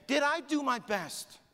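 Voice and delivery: high, drawn-out re-enacted cry; slow, high impassioned yell. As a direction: cry the question out slowly. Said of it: "And then he said it again".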